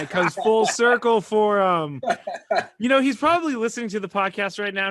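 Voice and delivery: talking through real laughter; giggling while picturing him listening; laughing. Real, not acted. Laughing at the Wentz joke.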